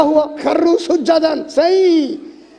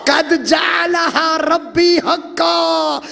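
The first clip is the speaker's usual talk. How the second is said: slow, raised Quranic chant then translation; 5 semitones higher, slower than usual. Acted. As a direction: intone it like a preacher quoting scripture. [name] quotes verse.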